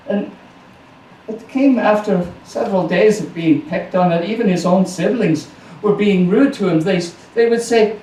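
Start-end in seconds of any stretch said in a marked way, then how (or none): none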